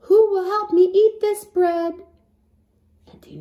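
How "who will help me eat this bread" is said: high, loud sly question; hen asking. Acted. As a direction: ask it sweetly, setting a trap. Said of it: "She asked them one last time".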